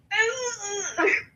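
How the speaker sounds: sudden high broken squawk; glitchy yelp. Acted. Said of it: "What the heck was that?".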